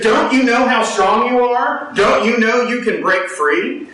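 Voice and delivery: high, slow earnest plea; pleading Englishman. Retold or imitated. Englishman urging the tied elephant, slow high plea. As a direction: plead with the elephant to see its strength.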